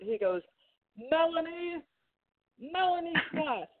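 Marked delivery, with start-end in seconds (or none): 1.1-3.7 high, called-out name, stretched like an announcement; bullhorn imitation. Upset director calling on the bullhorn, imitated high call. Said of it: "He's on the bullhorn".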